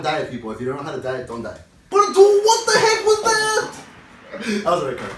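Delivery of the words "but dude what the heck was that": high, loud, drawn-out disbelief; incredulous outburst. Real, not acted. Player protesting a shock call in the game, incredulous outburst. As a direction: cry out slowly in shocked protest. Outraged reaction to a game foul.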